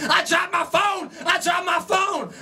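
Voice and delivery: high, drawn-out mock scream; screaming fan. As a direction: imitate a hysterical fan wailing at a concert. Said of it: "she just starts screaming".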